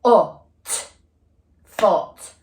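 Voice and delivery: "Foot" chopped short and loud; robot-arm segmenting. Shown as a demonstration. Teacher doing robot arms with pupils, short loud beats. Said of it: "Let's do a robot arms".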